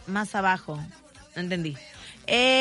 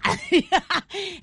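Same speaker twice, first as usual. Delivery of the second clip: laughing; 7 semitones higher than usual. Real, not acted. Laughs at own joke.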